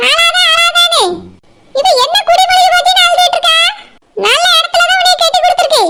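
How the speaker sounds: high-pitched maternal nagging at normal volume; scolding mother. Acted. Mother telling the weeping bride to stop crying, scolding. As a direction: keep chiding in a high, exasperated voice.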